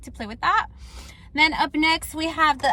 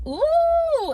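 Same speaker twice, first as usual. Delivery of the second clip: impressed ooh; 12 semitones higher, 4 dB louder than usual. Real, not acted. Testing a sharp felt-tip eyeliner.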